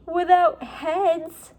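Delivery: put-on high, slow girl's voice, uneasy; small girl speaking slowly in the dark; Annie's voice. Annie's scared remark in armory.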